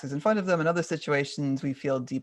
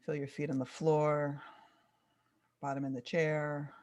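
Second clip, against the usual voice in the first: soft, unhurried guided breathing; calm grounding exercise; 3 dB quieter than usual. Acted. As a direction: gently lead the listener into stillness.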